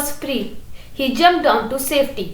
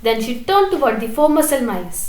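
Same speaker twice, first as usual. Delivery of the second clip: plain reading voice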